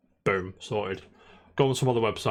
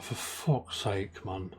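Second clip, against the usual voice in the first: slow, soft, sinking curse; muttered to himself, fed up with the parts; exasperated mutter; 2 semitones lower, 7 dB quieter, much slower than usual. Retold or imitated. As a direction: trail off in defeat. Retelling his own fuming.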